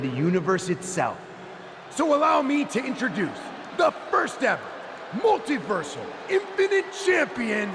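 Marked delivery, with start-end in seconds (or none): 1.9-7.7 grand announcement. Acted. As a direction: build it up like a ring announcer revealing a star. Miz unveils his new champion.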